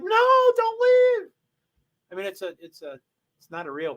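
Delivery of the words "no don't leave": high, loud mock plea of a disappointed audience; pleading crowd. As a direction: cry out much higher, begging him to stay. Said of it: "they're like".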